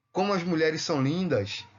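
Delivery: normal talking voice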